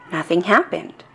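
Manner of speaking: plain reading voice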